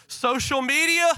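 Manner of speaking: loud, high questioning call; mock distraction. Preacher acting out distractions from Jesus, high mock-excited query. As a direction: toss it up high like a tempting interruption.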